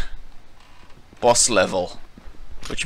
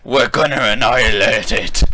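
ordinary talk, nothing marked

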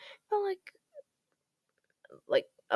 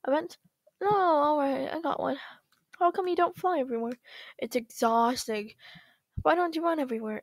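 ordinary delivery

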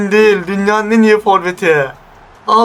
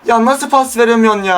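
ordinary talk

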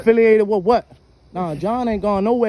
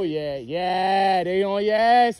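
normal talking voice

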